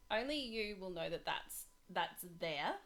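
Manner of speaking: normal talking voice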